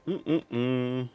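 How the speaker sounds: ordinary delivery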